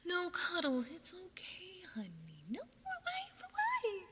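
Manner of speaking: high, pleading coo rising to a squeal; high-pitched distressed cooing; dismayed consoling. Real, not acted. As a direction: plead with someone not to cry. Dismayed that a viewer is upset.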